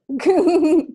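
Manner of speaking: a quick high laugh; laugh, delighted; short delighted laugh; laughter. Real, not acted. Laughing at the question.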